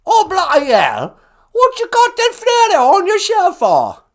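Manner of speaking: loud, shrieking falsetto caller; outraged high caricature; Queen Elizabeth. Acted. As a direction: screech indignantly in a high British put-on voice. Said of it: "Hey, Queen Elizabeth".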